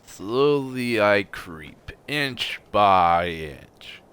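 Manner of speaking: very slow, drawn out; slow dramatic narration. Pretend. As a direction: stretch each word out. Narrating his slow crawl.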